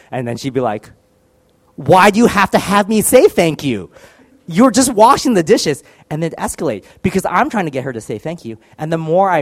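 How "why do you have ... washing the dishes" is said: high, loud mock wife, exasperated; imitating annoyed wife. Wife scoffing at husband over the dishes, loud and high. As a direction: play the fed-up wife scoffing at him.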